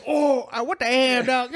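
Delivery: high, comic disbelief; hammed-up dismay, near laughter; mock shock. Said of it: "You're like".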